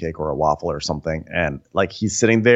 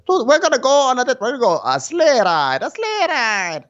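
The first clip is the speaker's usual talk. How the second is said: very high, giddy put-on voice; hammed-up high squeal of excitement; mock excited suitor; 3 dB louder than usual. Imitating him heading out on a date, high excited voice.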